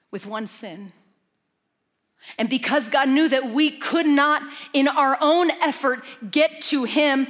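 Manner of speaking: fiery sermon climax; preaching at full force. Acted. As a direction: drive the point home to the church.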